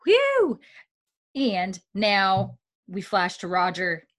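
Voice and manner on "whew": relieved whew. Real, not acted. Relief after tense recap.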